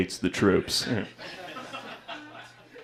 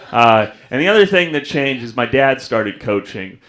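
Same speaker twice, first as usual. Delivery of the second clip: ordinary delivery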